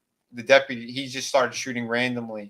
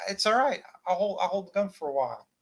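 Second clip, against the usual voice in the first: higher, faster mock reassurance; falsely calm; 7 semitones higher than usual. Deputy shrugging off the question, high mock calm. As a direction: brush it off a bit too quickly. Deputy soothing while keeping gun.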